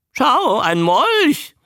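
slow, high, loud creature croak; high-pitched newt voice; talking newt. A narrator's reading. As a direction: squawk it like a parroting newt. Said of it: "ertönte es hinter ihm".

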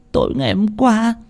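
sobbing. A narrator's reading. Tân telling Ông Phú what happened, sobbing. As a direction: begin through hiccuping sobs. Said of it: "khóc nấc lên".

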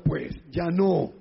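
ordinary talk

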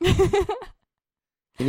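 mock high voice. High drawn-out playful question.

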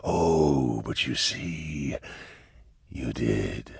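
low, slow demon purr; deep voice, every word drawn out; Cherubael. A narrator's reading. Cherubael answers the man who summoned him, slow and eerie.

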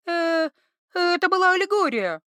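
high, nervous explanation; flustered girl. Acted. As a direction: squeak out the excuse. Iva dangling, explaining nervously.

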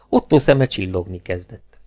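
plain reading voice

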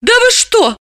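a scandalised lady; indignant woman. Acted. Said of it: "возмутилась Далила".

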